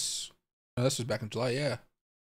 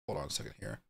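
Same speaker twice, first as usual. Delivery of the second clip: whisper; 12 semitones lower, 17 dB quieter, faster than usual. Real, not acted. Muttering to himself mid-search.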